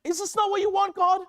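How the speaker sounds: high-pitched exasperated plea to God; acted-out frustrated complaint. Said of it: "I'm, like, getting frustrated".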